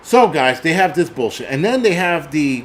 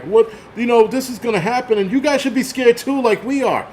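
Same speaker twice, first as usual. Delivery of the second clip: much higher mock-panicky scientist voice; mocking scared scientists; 8 semitones higher than usual. Vlogger mocks the scientists warning the public, in a high scared voice. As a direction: squeak it out like a frightened nerd. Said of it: "They're like".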